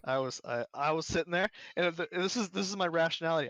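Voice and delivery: ordinary talk, nothing marked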